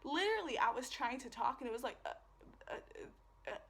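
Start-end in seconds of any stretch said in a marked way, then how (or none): 2.0-3.6 imitating a voice that can't get words out; choked, halting syllables with gaps between; broken, cracked speech. Recalling trying to talk after being hit, broken halting voice. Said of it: "sounded exactly like that".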